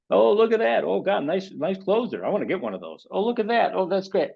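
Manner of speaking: high, gushing envy while scrolling; mock-envious oohing at social media. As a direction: gush over other people's stuff.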